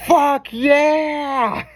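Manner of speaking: high, loud mock whoop; excited cheer. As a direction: cheer like great news just landed. Friend cheering on the phone.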